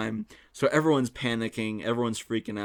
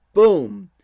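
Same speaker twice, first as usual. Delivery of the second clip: sudden loud, high boom; explosive impact; 8 semitones higher, 16 dB louder than usual. Retold or imitated. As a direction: punch it out like a door slamming. Acting out the door impact.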